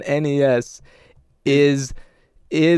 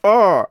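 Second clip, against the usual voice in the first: high loud heroic grunt; Ultraman cry; 8 semitones higher, 6 dB louder than usual. Retold or imitated. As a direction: belt out a short superhero yell. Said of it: "he goes".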